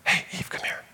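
breathy serpent whisper in her ear. Pretend. Serpent whispering to Eve to come talk. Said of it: "whispering in her ear".

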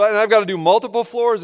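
normal talking voice

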